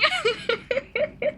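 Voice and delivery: high, helpless laughter; tearful laughing fit. Real, not acted. Streamer cracking up at their own gaffe, helpless laughter. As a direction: dissolve into high giggles till you cry. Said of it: "I'm crying".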